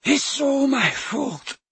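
high slow sobbing voice. A narrator's reading. Dumbledore confessing in despair, high slow sobbing. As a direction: sob out the guilt. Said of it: "he sobbed".

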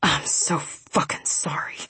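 slow whispered rasp. A narrator's reading. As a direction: rasp out a hushed, sincere apology. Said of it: "he rasped".

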